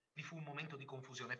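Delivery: plain reading voice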